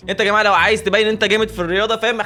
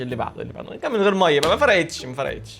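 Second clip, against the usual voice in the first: ordinary talk, nothing marked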